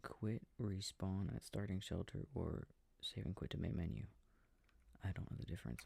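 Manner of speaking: usual speaking voice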